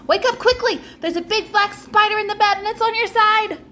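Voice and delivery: put-on a girl faking panic to frighten her twin; scary and urgent. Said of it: "making her voice scary and urgent".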